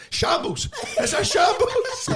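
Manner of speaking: high, slow, laughing "shambles"; laughing mock despair. Crofty on stage after the mix-up, wailing high through laughter. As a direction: wail it through laughter like a pal mortified on stage. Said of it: "Crofty's gone".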